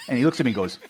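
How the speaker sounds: normal talking voice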